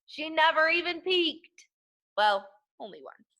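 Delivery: ordinary delivery, nothing marked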